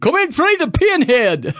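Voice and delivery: high, loud laughing barker call; circus barker. Pretend. Host imagining his circus sign, laughing barker call. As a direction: hawk it like a carnival barker.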